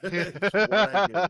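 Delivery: loud, high, slow brag bursting into laughter; laughing brag. Real, not acted. Player crowing over shredding an enemy, laughing loud. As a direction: crow it through a big laugh.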